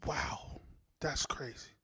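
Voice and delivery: soft unvoiced amazed whisper; awed whisper. Real, not acted. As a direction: breathe it out in awe. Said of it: "whispers in awe".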